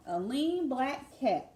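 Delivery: lilting read-aloud refrain; sing-song lullaby reading. Acted. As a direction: chant the refrain like a lullaby rhyme.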